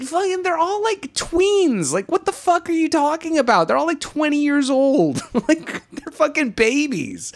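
disbelieving squeal at whiny friends; high-pitched comic outrage; exasperated rant. Real, not acted. Ranting at complaining friends.

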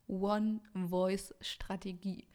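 slow, careful through braces; carefully shaping "One-Voice-Strategie" around braces; talking with braces. Real, not acted. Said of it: "das mit Zahnspange auszusprechen, ist echt fies".

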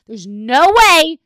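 high, loud, drawn-out exclamation; emphatic disbelief. Retold or imitated. Acted gushing over daughter's outfit.

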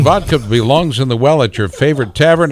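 upbeat announcer commercial voice; ad read. Acted. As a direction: sell it like a radio spot. Mock vodka commercial read.

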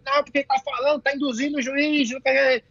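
high-pitched mock protest; half-voiced complaining holler; retold yelling. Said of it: "Começou a gritar".

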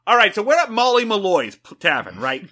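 put-on loud Boston accent barroom storyteller. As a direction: launch into a brash Boston-accented yarn. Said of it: "That was a solid Boston".

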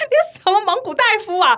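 high laughing indignant rant; laughing outrage. Retold or imitated. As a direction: laugh through mock outrage. Angry retelling laughing.

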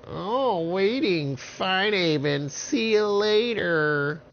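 high sneering witch voice; mock him in a high witch voice. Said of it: "And the witches said".

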